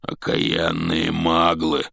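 slow growl from the giant; growled curse. Acted. Hagrid growls about the Muggles, slow growl. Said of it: "прорычал Хагрид".

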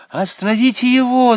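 high, loud cry of alarm; alarmed girl's scream. A narrator's reading. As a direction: shout for someone to stop him. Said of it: "закричала Наташа".

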